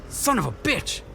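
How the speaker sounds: high, furious exclamation; outraged outburst; angry curse. Acted. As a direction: curse at being robbed. Realising his wallet was stolen.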